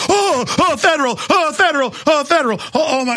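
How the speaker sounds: high fawning gasps; mock swooning. People fawning at a Federal shirt, mock swoon. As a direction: swoon over the word. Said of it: "and people just".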